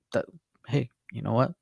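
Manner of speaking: ordinary talk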